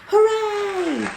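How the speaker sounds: high, loud cheer; villagers cheering. A narrator's reading. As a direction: shout hooray in triumph. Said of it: "villagers shouting".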